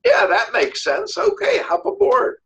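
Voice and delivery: slow start, rising high on the invitation; won-over agreement. Acted. Frog agreeing to carry the scorpion, slowly won over. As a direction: give in agreeably, lifting the pitch.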